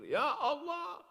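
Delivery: high, wailing zikir cry; sudden high, drawn-out plea; Zul Arafah. Retold or imitated. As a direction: mimic a tearful prayer leader's outcry. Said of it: "Ustadz Zul Arafah kalau udah berzikir".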